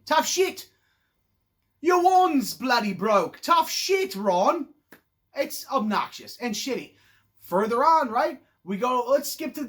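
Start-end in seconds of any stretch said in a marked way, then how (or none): 0.1-4.7 taunting Tough shit slowly, a bit louder; mocking Harry. Mocking Harry taunts Ron about his broken wand, slow and louder. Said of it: "He just goes".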